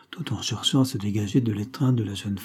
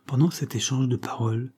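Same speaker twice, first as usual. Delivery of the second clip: plain reading voice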